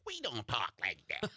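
mock Wisconsin commenter voice; Wisconsin accent. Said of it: "comments are like".

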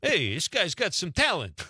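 lightly voiced mafia hitman; bad hitman mafia voice. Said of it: "That's my bad hitman mafia voice".

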